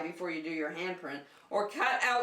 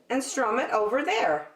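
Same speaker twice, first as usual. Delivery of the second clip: higher helpful zebra voice; Zebra's voice; 4 semitones higher, 6 dB louder than usual. Acted. Zebra showing Bear the guitar, higher and helpful. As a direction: show him kindly, a bit higher.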